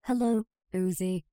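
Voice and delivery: ordinary delivery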